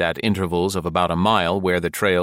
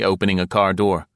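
plain reading voice

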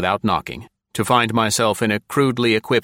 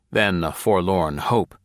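usual speaking voice

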